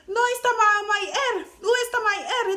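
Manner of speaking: high, loud mock panic in mixed Spanish and English; panicked broken Spanish. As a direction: plead in broken Spanish for your hair. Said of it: "je dis".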